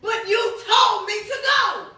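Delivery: high, loud, exasperated plea; retold loud protest. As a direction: protest loudly as if pleading with the Lord.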